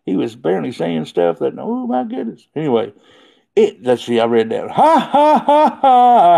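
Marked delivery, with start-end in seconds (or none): 4.7-6.3 mock laugh. Reading a laughing comment aloud.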